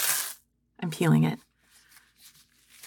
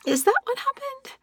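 mortified disbelief; 4 dB quieter than usual. Acted.

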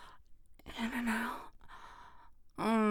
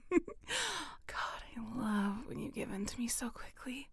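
normal talking voice

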